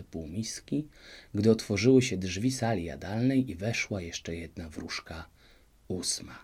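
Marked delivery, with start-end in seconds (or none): none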